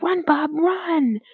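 high, drawn-out cry; urgent cry. A narrator's reading. Brother urges him up the hill, urgent cry. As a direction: cry it out high, stretching the words. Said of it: "cried Brother".

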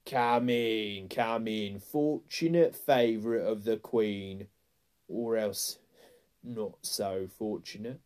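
slow, drawling menacing wolf; slow mock welcome turning to a threat. A narrator's reading. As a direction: stretch the words out as a sinister wolf. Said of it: "came bounding back and said".

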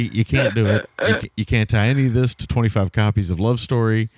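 usual speaking voice

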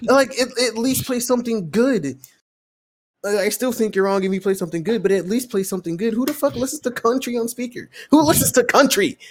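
high-pitched, indignant complaint; exasperated rant. Real, not acted. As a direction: protest it in a high, worked-up voice. Rant about country music on speaker.

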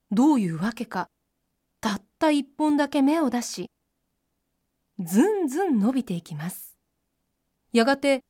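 plain reading voice

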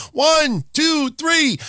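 referee count. Retold or imitated. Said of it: "a referee in the background go".

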